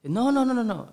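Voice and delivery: high, rapid-fire mock refusal; refusing mother. Mother turning down her son's plea, refusing. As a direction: imitate a firm no, pitched high.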